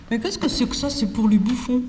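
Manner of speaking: a light Marseille accent on the defiant line. Shown as a demonstration. Teacher redoes the rude pupil in Marseille accent. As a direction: toss it off with a hint of Marseille. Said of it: "je le refais avec l'accent d'ici".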